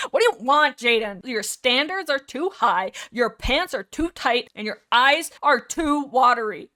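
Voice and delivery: hammed-up telling-off, punched words; mock yelling. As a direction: yell at yourself in exaggerated exasperation. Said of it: "because I'm yelling at you".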